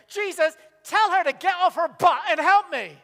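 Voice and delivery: furious complaining yell; indignant demand. Retold or imitated. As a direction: Martha demanding Jesus make her sister help. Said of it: "Martha comes in and goes".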